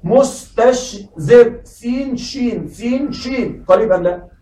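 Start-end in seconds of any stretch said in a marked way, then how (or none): 0.0-1.6 very slowly, syllable by syllable, مستشزر; slow careful reading. Shown as a demonstration. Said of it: "اقرأ معي هذه الكلمة". 1.6-3.6 سين شين said softly and slowly, stressing سين; name the letters سين شين gently to show they sit close; naming the letters. Shown as a demonstration. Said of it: "اقرأ معي".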